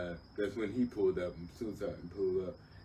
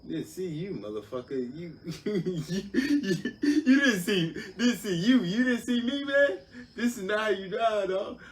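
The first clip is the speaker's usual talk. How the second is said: laughing rant; 11 semitones higher, 7 dB louder, slower than usual. Retold or imitated. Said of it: "I'm like".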